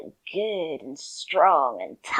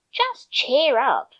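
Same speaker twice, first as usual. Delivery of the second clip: mock cheer up; 2 semitones higher, 8 dB louder, slower than usual. Quoting dismissive advice.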